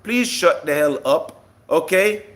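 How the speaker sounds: ordinary talk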